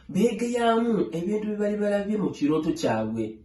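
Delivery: plain reading voice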